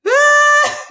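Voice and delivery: loud, high wail at the daycare; retold crying wail. Mother handing her son to the teacher, loud crying wail. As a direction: let out a cry like handing over the baby. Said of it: "and I was like".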